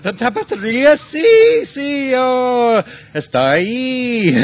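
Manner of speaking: jokey pretend Spanish reply, squealing with delight; hammed-up Spanish excitement. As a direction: overplay a thrilled local pointing to the shoe shop.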